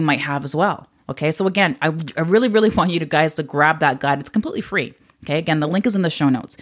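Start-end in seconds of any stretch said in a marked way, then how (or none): none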